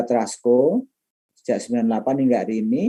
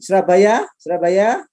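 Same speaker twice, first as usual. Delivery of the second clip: high rising questions; calling out; 8 semitones higher, 5 dB louder than usual. Real, not acted. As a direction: call the city out, then repeat it. Calling out cities to audience. (memanggil dengan nada naik)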